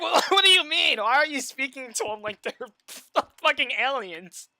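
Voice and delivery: incredulous retort. Retold or imitated. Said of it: "It's like".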